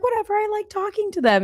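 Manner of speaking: a high, lightly mocking take on her husband shrugging it off. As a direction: toss it off like a breezy husband. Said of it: "But he's like".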